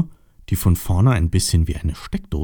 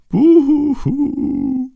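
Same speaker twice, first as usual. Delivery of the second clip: very high, drawn-out boo-hoo; hammed-up storybook wailing, pitched way up; mock crying; 4 dB louder than usual. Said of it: "weinte der Busch".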